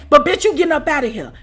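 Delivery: normal talking voice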